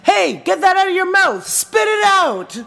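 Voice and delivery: retold yell at a drunk customer, played for laughs; imitating bartender yelling. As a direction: bark it across the bar like a bartender calling out a customer. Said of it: "we hear from the bartender".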